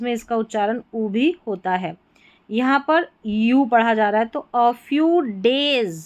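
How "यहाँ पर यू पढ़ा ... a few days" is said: ordinary talk, nothing marked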